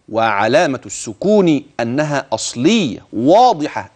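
usual speaking voice

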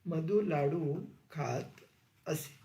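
quiet, slow repeat of the dictation. Shown as a demonstration. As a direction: say it again slowly and drop the voice toward the end. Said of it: "रीती भूतकाळ".

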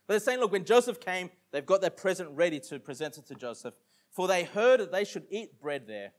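ordinary talk

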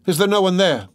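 calling out. A narrator's reading. Dunya seeking help at the locked door, calling out. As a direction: call out for anyone beyond the door. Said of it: "she called, shaking the door".

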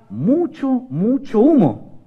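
very slow, stretched words; drawn-out emphasis. Acted. Storyteller telling children of heavy smoke, drawn-out emphasis. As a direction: draw out each "mucho".

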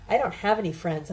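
plain reading voice

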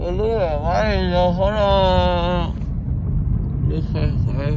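speaking with mouth packed; muffled post-dental speech; talking around gauze. Real, not acted.

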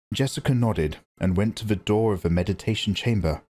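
usual speaking voice

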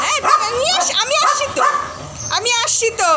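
very high, sing-song reassurance. Real, not acted. Owner promising her dog she is coming, high baby talk. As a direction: soothe an eager pet in a squeaky voice.

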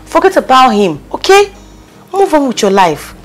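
loud, insistent pep talk; emphatic urging. Acted. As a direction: push a friend firmly to move on. Friend urges crying friend onward.

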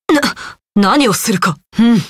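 ordinary talk

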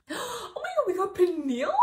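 high, loud thrilled shriek; excited shriek. Real, not acted. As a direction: shriek with delight at the pull. Pulls favorite's card.

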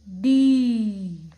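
डी loud, with a long ee; long-vowel demonstration. Shown as a demonstration. Teacher joins letter and matra, long loud डी. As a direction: call out a stretched डी. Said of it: "इसका उच्चारण".

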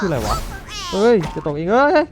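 loud high startled shouting; excited yell. Real, not acted. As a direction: yell as the rival tumbles off. Opponent falls off edge.

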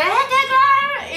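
high, loud and slow exclamation. Retold or imitated. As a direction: blurt it out too loud, laughing. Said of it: "[name], estás gritando". (exclamación fuerte y aguda)

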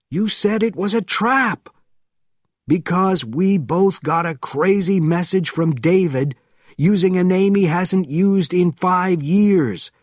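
urgent talk while fleeing. A narrator's reading. Panicked exchange while fleeing.

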